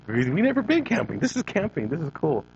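put-on high, wide-eyed kid voice; a small boy, amazed and delighted by camping; excited child voice. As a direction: sound like a thrilled little boy discovering camping. Child self thinking it's camping.

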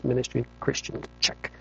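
quiet, matter-of-fact checklist read; pilot reading a checklist. Retold or imitated. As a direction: tick the items off softly like a pilot in the cockpit. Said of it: "imagine a pilot reading a checklist".

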